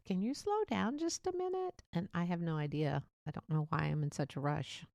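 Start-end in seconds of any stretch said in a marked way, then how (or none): 0.1-1.8 sister's plea. Retold or imitated. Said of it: "my sister has even said".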